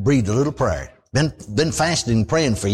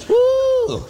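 a sudden high whoop of delight; quick high-pitched woo of glee; joyful whoop. Real, not acted. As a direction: whoop like you just struck it rich. Dreams of millions, whoops.

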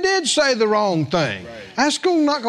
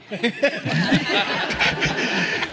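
soft breathy laugh; 4 dB quieter than usual. Real, not acted. Preacher laughing while retelling the man, breathy laugh. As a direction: chuckle through the quote.

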